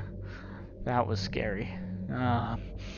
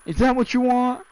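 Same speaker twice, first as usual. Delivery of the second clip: high, loud indignant rant; mock indignant; 8 semitones higher, 4 dB louder than usual. Gamer scolding the ghost who screamed at him, hammed-up loud indignation. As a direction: scold it like a wronged friend.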